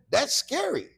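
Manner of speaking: ordinary talk, nothing marked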